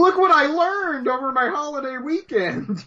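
put-on high-pitched boastful kid voice; mock eager kid. Podcaster mocking an amateur video editor, eager kid voice.

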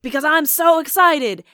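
high, loud mock enthusiasm; hammed-up excited promoter, raising voice and pitch; excited Don King. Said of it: "he was just so excited".

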